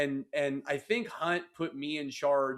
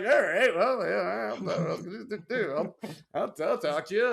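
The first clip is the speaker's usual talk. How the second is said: flustered agreement; 2 semitones higher than usual. Retold or imitated. Acts out his flustered reply.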